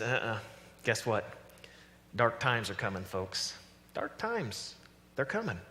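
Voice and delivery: soft, ominous warning; understated voice of a prophet of dark times; Jeremiah the prophet. Acted. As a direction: break bad news quietly, with pauses. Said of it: "Jeremiah, who actually hears from the Lord".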